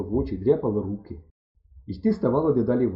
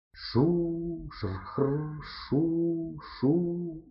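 soft drawn-out shoo-shrkhr of leaves; hushed imitation of rustling corn; imitated rustling; 4 semitones higher, 7 dB quieter, much slower than usual. A narrator's reading. Імітує шурхіт кукурудзи. (тихо імітує шурхіт)

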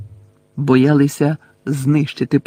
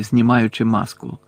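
ordinary delivery, nothing marked

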